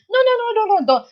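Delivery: high, hammed-up scolding of a baby; mock correcting. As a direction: play a parent cutting the child off with a playful no. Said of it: "don't correct your baby and say".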